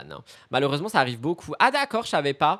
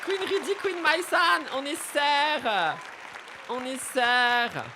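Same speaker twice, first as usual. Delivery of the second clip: much higher, slow and drawn out, a gushing queen; mock diva gush; 8 semitones higher, 4 dB quieter, slower than usual. Streamer gushing to viewers that they are sisters, high and slow. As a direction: stretch "sœurs" out high and slow like a proud diva.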